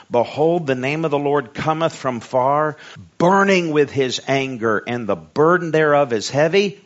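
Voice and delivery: ringing, emphatic Bible reading; scripture reading. A narrator's reading. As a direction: proclaim the verse with rising force.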